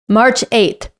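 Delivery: usual speaking voice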